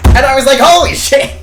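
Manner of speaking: very high, very loud outburst; shocked yell. Retold or imitated. Player reacting to the elf's unexpected voice, shocked high yell. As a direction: yell it in stunned delight.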